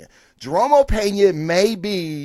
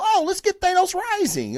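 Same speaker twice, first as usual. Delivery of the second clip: much higher, lightly voiced clueless dad; mock cheerful dad. Dad answering his kid's Thanos question, mock-cheerful and high. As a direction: sound like a naive father happily agreeing. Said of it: "You go".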